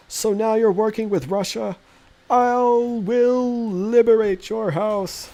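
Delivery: high, slow, silly put-on voice; high, loud, drawn-out comic voice; weird voice for chat. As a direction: read the chat message in a goofy exaggerated voice. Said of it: "I get to read your voices aloud in a very weird way".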